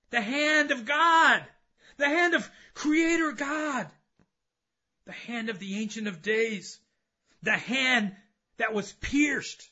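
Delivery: high, loud preacher's cry; full-force preaching. Acted.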